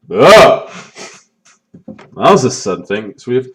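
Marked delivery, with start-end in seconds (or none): 0.1-0.7 disgusted groan. Real, not acted. Sudden ending reaction.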